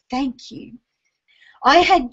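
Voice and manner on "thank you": soft unvoiced whisper, much softer. A dying dog's felt thanks, whispered. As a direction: breathe the thanks out without voice.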